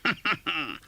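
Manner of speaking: rich, jovial, high calling out; a jolly old master calling. Acted. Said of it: "cried old Fezziwig".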